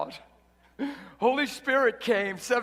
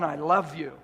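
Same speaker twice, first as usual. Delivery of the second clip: "I love you" said lower, welling up from inside; bursting love; 7 semitones lower than usual. Retold or imitated. Preacher showing love that overflows, a touch of heartfelt release. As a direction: let the love spill out freely, understated. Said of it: "that bursts".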